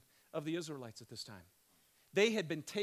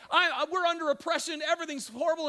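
usual speaking voice

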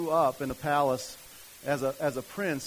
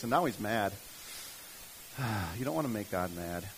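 ordinary talk

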